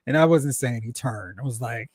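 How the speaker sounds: normal talking voice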